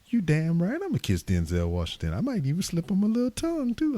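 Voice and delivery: sassy wife quoted by her husband; imitating wife. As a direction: play the wife, teasing and sure of herself. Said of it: "She's like".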